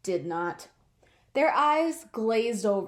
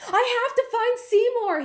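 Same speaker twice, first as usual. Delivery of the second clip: higher, louder panicked plea; worried cat rushing to find his friend; urgent worry; 11 semitones higher, 5 dB louder than usual. Acted. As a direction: insist with rising panic.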